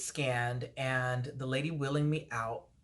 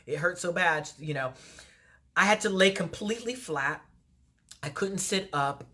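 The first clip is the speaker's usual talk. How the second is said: normal talking voice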